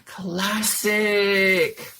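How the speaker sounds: loud, high, cocky teasing voice; gloating prankster. Acted. Jones gloating about the prank to his friends, cocky. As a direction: gloat over the joke.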